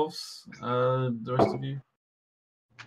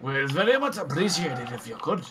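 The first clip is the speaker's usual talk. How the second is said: put-on wobbly Scottish accent. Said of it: "what the fuck is going on with this accent".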